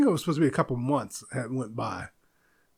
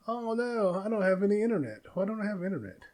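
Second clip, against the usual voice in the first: dismayed; 5 semitones higher, 5 dB quieter than usual. Real, not acted. Discovers internet is down while recording.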